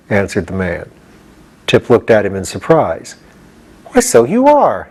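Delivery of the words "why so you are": high surprised realization; the boy suddenly sees it; surprised exclamation. A narrator's reading. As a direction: catch the surprise. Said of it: "he exclaimed".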